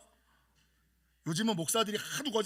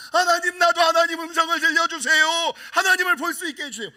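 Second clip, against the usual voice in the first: higher, louder pleading; begging prayer; 8 semitones higher, 10 dB louder than usual. Retold or imitated. A young believer begging God to hear him, loud and high. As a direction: beg God like a child.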